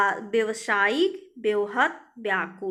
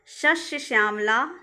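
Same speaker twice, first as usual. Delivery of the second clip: ordinary talk, nothing marked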